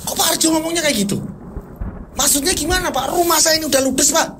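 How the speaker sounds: high-pitched, boiling-over protest; indignant and worked up, voice pitched high; angry outburst. Acted. Husband to shaman about his burnt house, angry outburst. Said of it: "Udin meluap-luap di sini. Marah di sini".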